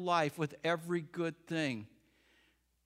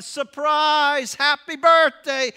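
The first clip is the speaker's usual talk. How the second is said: loud, high crowd shout; full-voice surprise party shout; 9 semitones higher, 15 dB louder, much slower than usual. Acted. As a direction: yell it as the door opens. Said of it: "everyone shouted".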